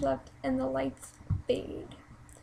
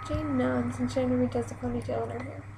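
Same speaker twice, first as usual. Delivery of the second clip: ordinary delivery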